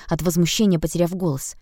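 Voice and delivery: ordinary delivery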